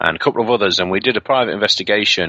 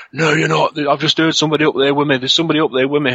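mildly alarmed, raised-pitch protest; agitated insistence; 7 semitones higher than usual. Retold or imitated. Friend Tim argues with his colleague, agitated insistence. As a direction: imitate a rattled friend insisting.

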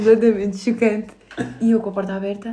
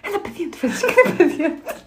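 high, laughing call to come inside; fussy nagging call from the doorway; imitating boyfriend; 10 semitones higher than usual.

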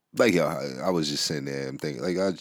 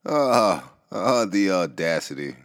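the audacity; 2 semitones higher, 6 dB louder, slower than usual. Acted. Dramatic outraged opener.

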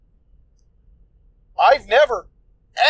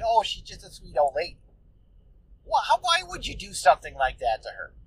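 softer, lightly voiced gentle conscience; angel on shoulder; 5 dB quieter than usual. Angel on his shoulder pleading for the old lady, lightly voiced and softer. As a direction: coax him out of it like a mild little angel. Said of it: "little goddamn angel is on my shoulder saying".

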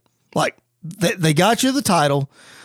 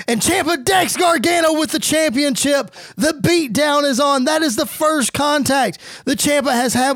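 raised, excited commentary call; high energy play-by-play of the attack; hyped play-by-play; 5 semitones higher than usual. Acted. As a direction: call the beatdown like a ringside announcer. Live play-by-play of beatdown.

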